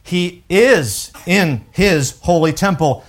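is stressed and slowed in He is in His holy temple; slow weighty is; emphatic is. Shown as a demonstration. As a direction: lean hard on is. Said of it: "notice those are emphatic terms".

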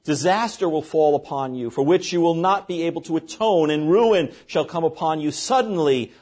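normal talking voice

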